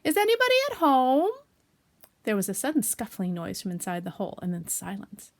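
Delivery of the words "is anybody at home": high, loud sing-out question; raised hallooing voice; calling out. Acted. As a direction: call down a hole to whoever's inside. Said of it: "and called out".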